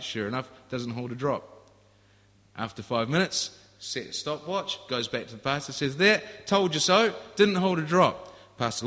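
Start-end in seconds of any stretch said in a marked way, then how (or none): 6.0-8.3 loud, high told-you-so crow; gloating young man. Retold or imitated. Young man gloating to the pastor, loud and high. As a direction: crow it back at him like you won.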